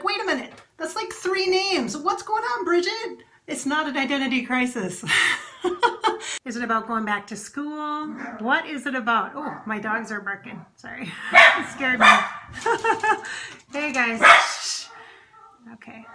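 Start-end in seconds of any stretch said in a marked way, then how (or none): 0.0-3.2 puzzled viewer. Pretend. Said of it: "You're like". 9.3-11.1 whispered aside. Real, not acted. Aside while dogs bark.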